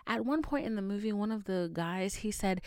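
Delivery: ordinary talk, nothing marked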